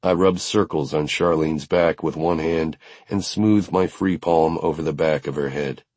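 usual speaking voice

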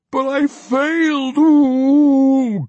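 very high, loud, slow wail ending in a dog howl. A narrator's reading. The failed mage wails to Pwent, howling like a dog. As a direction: wail it until it turns into a howl. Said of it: "Bitterdew wailed".

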